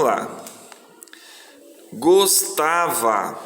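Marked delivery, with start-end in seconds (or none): 1.9-3.4 GOSTAVA slowly drawn out as "gos-ta-va"; syllable by syllable. Shown as a demonstration. Teacher setting pupils the word to divide, slow syllables. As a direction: stretch each syllable of GOSTAVA. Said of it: "A primeira possibilidade de separar".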